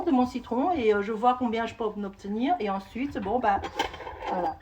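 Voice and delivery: normal talking voice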